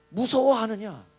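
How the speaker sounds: loud, firm mock rebuke; rebuking question. Preacher voicing Jesus to the fearful disciples, loud rebuke. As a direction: put the question forcefully, as a reproach.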